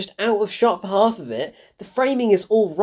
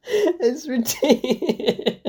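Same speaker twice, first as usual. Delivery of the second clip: high, slow, swallowed by laughter; cracking up; 6 semitones higher, much slower than usual. Real, not acted. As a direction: collapse into laughter mid-word. Cracking up at the stereotypical accent.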